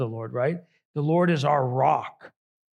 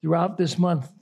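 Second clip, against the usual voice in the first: ordinary delivery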